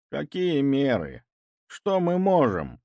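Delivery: slow, high, grieving sigh; despairing old man. A narrator's reading. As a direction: lament like a broken old man. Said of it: "горестно вздохнул старик Филатов". (горестно)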